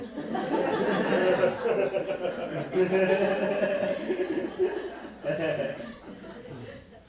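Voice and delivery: warm amused laugh at her reply. Real, not acted. Retelling the friend's reply, warm laugh. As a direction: laugh it out, delighted.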